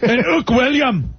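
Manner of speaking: high Irish rebel jeer; hammed-up Irish slogan; mock Irish. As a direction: cry it out in a comic Irish accent.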